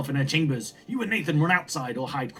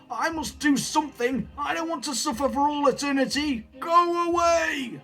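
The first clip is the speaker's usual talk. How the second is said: mad grandfather, tormented; 12 semitones higher than usual. Acted. Grandfather sends grandchild away, high and tormented=.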